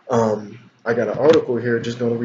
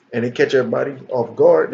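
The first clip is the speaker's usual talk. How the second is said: ordinary talk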